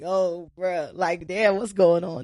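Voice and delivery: soft, low murmur of disbelief; retold stunned aside. Listener reacts to coworker's scolding, retold hushed disbelief. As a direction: drop it low, as if to yourself.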